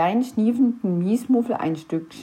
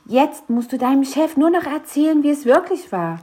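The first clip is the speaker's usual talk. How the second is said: excited; 7 semitones higher, 4 dB louder than usual. A narrator's reading. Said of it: "sagte Mathilda aufgeregt".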